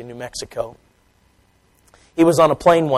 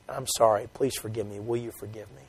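soft apology; 5 semitones lower, 13 dB quieter than usual. Acted. Apology across the aisle.

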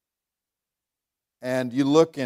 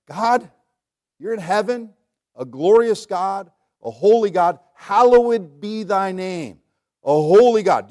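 higher, louder, slow praise to God; heartfelt prayer; 4 semitones higher, 5 dB louder than usual. Acted. Preacher models a praise prayer to God, fervent. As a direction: lift it up as worship. Prays in front of congregation.

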